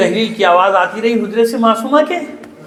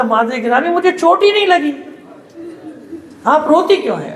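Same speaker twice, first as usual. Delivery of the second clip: a lightly voiced child, rising in puzzled wonder; child's wonder; 9 semitones higher than usual. A narrator's reading. Young Husain asking his mother why she weeps, puzzled. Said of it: "بچہ حیرت سے کہتا تھا".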